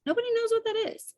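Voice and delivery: squeaky-high, quick insistence; high-pitched exasperation. Real, not acted. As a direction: leap up high and rattle it off. Exasperated repeat, much higher.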